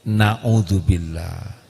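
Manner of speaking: low, very slow, pointed; slow joking recitation. Shown as a demonstration. Preacher joking about the dhikr for quarrelling couples, slow and low. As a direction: drag it out as a joke. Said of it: "bukan a'udzubillah, tapi".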